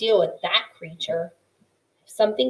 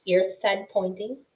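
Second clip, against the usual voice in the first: ordinary delivery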